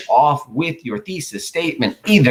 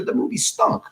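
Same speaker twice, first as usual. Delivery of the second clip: high whispered disgust; mock disgust, whispered. Shown as a demonstration. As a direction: sneer it in a breathy whisper. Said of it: "you just go right into it".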